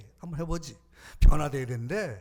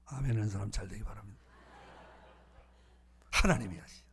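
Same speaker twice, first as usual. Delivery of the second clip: ordinary talk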